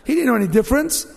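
usual speaking voice

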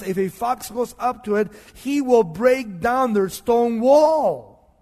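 acted rage building to a loud, drawn-out finish; preacher imitating an enraged enemy; furious. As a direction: thunder the threat slowly at the end. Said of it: "He was furious".

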